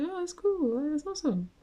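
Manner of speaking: high, bright-eyed fake cheer; mock enthusiasm. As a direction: play it overly impressed. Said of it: "I'm just like".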